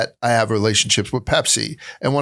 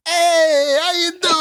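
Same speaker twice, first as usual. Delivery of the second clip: much higher, drawn-out neighborhood hello; deli loudmouth greeting. Retold or imitated. Host imitating the greeting at a neighborhood deli, high loudmouth. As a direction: call it out high like a deli counterman. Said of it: "it's totally that".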